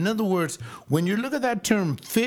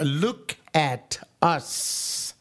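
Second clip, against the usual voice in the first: very slow, every word of "Look at us" stretched; drawn-out command; much slower than usual. Retold or imitated. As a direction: hold them with a long slow order. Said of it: "in the form of a command".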